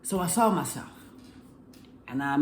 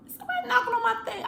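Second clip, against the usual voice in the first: much higher and fast, mocking; mock squeaky voice; much faster than usual. Vlogger mocking the night visitor at the van window, squeaky and fast. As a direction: squeak it out quickly like a cartoon.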